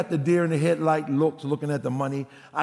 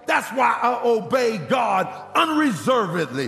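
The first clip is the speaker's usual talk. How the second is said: preaching at full force; 6 semitones higher than usual. Acted. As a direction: drive the lesson home to the congregation. Preaching climax with shouts.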